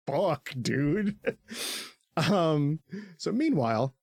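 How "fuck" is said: ordinary talk, nothing marked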